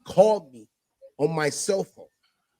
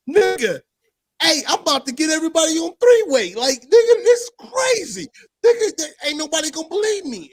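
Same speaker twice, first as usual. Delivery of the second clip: much higher and louder, near shouting; excited disbelief; 10 semitones higher, 7 dB louder than usual. Retold or imitated. Seeing his late brother in a dream, excited shout. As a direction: burst out like you can't believe it.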